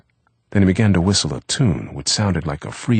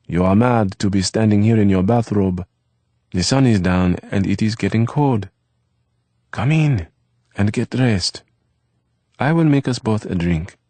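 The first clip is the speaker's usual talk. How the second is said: lightly voiced young French boy, higher; Eve's voice; 5 semitones higher, 4 dB louder than usual. A narrator's reading. Eve to Eric about dinner, lightly voiced boy. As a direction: Eve brightly telling Eric the plans.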